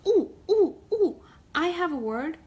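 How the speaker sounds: high, loud eager ooh ooh. Acted. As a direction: bounce in your seat to be picked. Eager crab raising claw.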